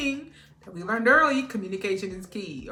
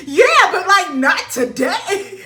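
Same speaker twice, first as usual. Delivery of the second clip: laughing protest; 9 semitones higher, 12 dB louder, much slower than usual. Retold or imitated. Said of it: "I said".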